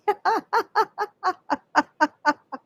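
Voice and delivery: high peal of laughter. Shown as a demonstration. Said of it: "laughing as you go".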